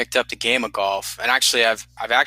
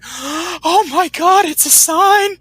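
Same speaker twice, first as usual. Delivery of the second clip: high, drawn-out awestruck gasp, louder; mock superstitious gasp; 5 dB louder, much slower than usual. Aunt hears of the prayer card, mock awestruck.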